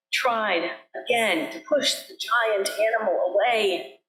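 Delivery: usual speaking voice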